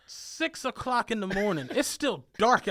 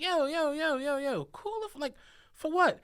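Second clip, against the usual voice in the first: higher, incredulous replay of his own words; exasperated protest; 9 semitones higher than usual. Retold or imitated. Driver retelling his reaction to the rider, exasperated and incredulous. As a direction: throw up your hands, what is this for. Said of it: "I'm just like".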